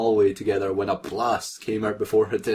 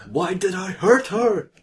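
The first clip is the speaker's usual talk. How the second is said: hammed-up angst; 10 semitones higher, 4 dB louder, slower than usual. Reading angst line dramatically.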